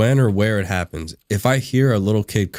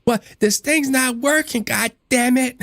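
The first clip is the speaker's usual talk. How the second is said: high, slow little-girl whine, put on. Streamer imitating a little girl cursing, high child voice. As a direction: imitate a small girl cursing at her broken Switch. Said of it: "she said".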